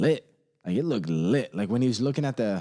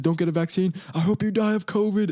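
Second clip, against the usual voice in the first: mock Joe Rogan; 8 semitones higher, 4 dB louder than usual. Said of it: "Joe Rogan says".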